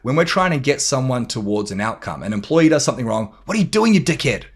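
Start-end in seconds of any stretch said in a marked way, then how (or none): 3.5-4.4 high-pitched, acted telling-off; angry boss. Boss to employee who did something wrong, mock angry. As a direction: lay into them for the mistake.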